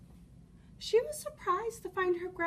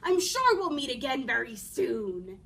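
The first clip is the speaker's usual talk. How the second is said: louder, low-pitched wolf farewell; calling after her; 4 semitones lower, 7 dB louder than usual. A narrator's reading. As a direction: call it out after the departing child. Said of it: "the wolf called after her".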